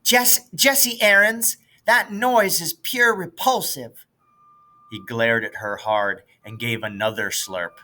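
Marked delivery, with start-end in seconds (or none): 0.0-4.1 put-on high, prim, disapproving girl; prissy girl scolding a slurping boy. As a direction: look down your nose at him. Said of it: "all priss-faced".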